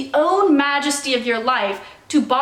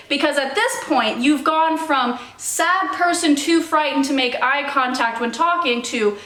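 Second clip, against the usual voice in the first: ordinary talk, nothing marked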